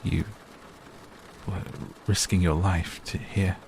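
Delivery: halting, broken by pauses; half strangled. A narrator's reading. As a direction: force the words out one at a time, choked. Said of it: "His words came out half strangled".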